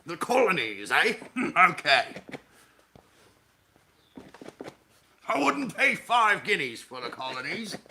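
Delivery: loud, high blustering British gent; fat Victorian British businessman. Acted. Scorning the colonies, loud British businessman caricature. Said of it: "a fat nineteenth century British businessman".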